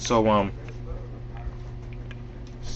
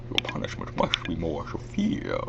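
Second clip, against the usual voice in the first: soft villain rasp from a tensed throat; tense the throat, let little voice through; Bane; 3 semitones lower, 8 dB quieter than usual. Shown as a demonstration. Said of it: "tense your throat muscles".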